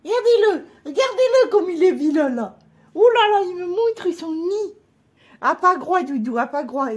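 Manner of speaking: high, fond scolding of a hen; high baby talk to a pet. Acted. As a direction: coo and tut at a naughty hen.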